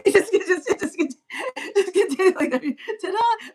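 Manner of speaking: breaking up, very high; laughing. Real, not acted. Reader tickled by just continuing to exist, laughing. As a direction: giggle helplessly through each restart. Giggling at her own joke.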